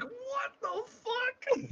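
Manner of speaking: high, slow, disbelieving what the fuck; drawn-out cry of exasperated disbelief; incredulous outburst. Real, not acted. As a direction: voice leaps high and stretches out in outrage. Outraged at comic dialogue.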